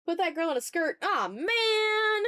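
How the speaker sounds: ordinary delivery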